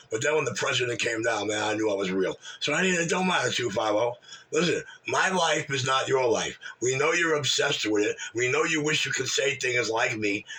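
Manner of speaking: normal talking voice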